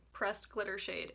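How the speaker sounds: ordinary talk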